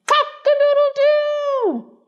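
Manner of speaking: very high, slow, stretched-out crowing; rooster crow. A narrator's reading. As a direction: crow it like a rooster waking the house. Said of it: "cried down from the beam".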